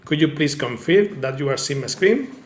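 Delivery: usual speaking voice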